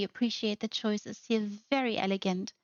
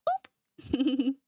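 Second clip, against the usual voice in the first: boop a tiny creature playfully; squeaky boop; 6 semitones higher than usual. Pretend.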